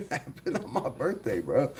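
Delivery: soft, laughing through the words. Real, not acted. Streamer laughing to chat about his birthday news. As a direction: laugh it out quietly in disbelief. Repeats line, second time laughing.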